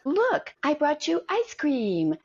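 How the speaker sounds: high, slow teasing imitation of the husband; a hint of a sing-song husband; mock husband voice. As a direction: lightly mimic him offering a treat.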